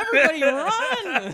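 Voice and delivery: high, loud laughing shout; mock alarm. Fans fleeing the building, mock panicked laughing shout. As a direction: yell it like sounding a stampede. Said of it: "we might not get more of this?".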